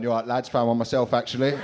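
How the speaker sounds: loud, chuffed announcement to mates; eleven-year-old showing the lads his find, loudly proud; proud schoolboy. Retold or imitated. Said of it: "Just turned up the next day going".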